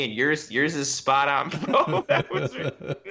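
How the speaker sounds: usual speaking voice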